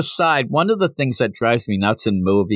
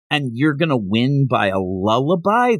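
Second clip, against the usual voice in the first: normal talking voice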